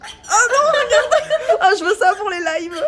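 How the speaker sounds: high, loud laughing squeal. Real, not acted. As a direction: squeal with delight. Reacting to cute hat, laughing.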